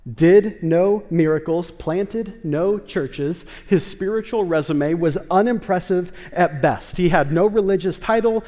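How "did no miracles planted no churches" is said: slow emphasis. Real, not acted. Preacher listing centurion's lack of credentials.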